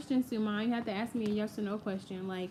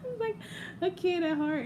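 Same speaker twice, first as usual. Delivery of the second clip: giggly; 9 semitones higher than usual. Real, not acted. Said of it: "I'm so childish".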